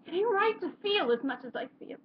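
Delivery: high, pained stage delivery; anguished closing line. Acted. Character ending a monologue on too much feeling, anguished. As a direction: let the last line ache out, pitched high.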